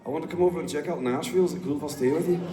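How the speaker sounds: playful imitation of a friend asking to visit; imitating Scottish mate. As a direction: rattle it off like an eager Glasgow lad. Imitating Lloydie on phone.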